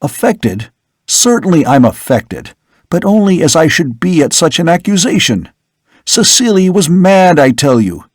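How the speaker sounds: a hint of outraged young man; indignant son.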